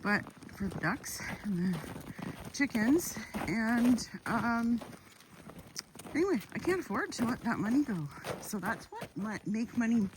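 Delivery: ordinary talk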